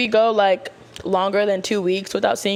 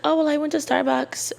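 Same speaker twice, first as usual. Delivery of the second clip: higher, mocking voice of someone making excuses; 6 semitones higher than usual. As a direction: lightly mimic a guilty excuse-maker. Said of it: "Like, don't be like".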